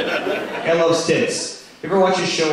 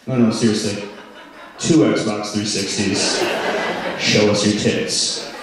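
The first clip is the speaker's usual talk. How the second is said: low, slow radio host voice; Howard Stern impression; 6 semitones lower, slower than usual. Radio host bribing a woman guest, lightly voiced low. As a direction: make a sleazy low radio offer. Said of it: "It's just like".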